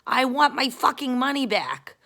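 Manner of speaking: high, loud re-enacted tirade; angry screaming. Customer demands refund from airline, retold angry screaming. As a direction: scream it down the phone, outraged. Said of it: "I was, like, screaming at American Airlines".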